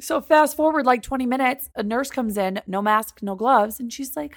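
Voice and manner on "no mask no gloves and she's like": normal talking voice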